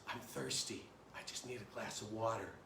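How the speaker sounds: very soft, drained plea; dying of thirst. Acted. Thirsty traveler pleads with tie seller for water, faint and weak. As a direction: act a parched man with little voice left. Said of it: "He's dying of thirst".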